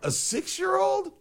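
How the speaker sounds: can't believe it; stunned, drawn-out disbelief. Real, not acted. Outraged disbelief, high and slow.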